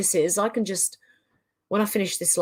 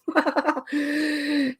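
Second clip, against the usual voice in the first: laughing; 7 semitones higher, 3 dB quieter than usual. Real, not acted. Laughing at own joke.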